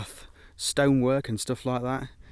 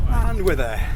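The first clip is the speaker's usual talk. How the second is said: excited; 6 semitones higher, 7 dB louder, slower than usual. Real, not acted. Reaching the summit.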